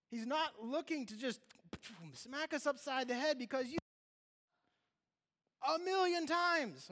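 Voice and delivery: preacher pressing the point to the congregation, high and loud; preaching. Acted. Preaching at full force.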